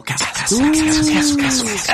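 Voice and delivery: rising high echoing chant; echo effect. Pretend. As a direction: echo the word like a canyon. Echo effect after title.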